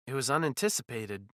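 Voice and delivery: ordinary delivery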